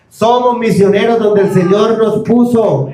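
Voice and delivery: ordinary talk, nothing marked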